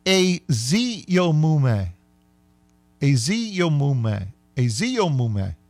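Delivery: slow Aziyomume, repeated; practising Aziyomume slowly; repeated practice. Shown as a demonstration. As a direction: drill Aziyomume slowly, over and over. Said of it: "let's try this slowly".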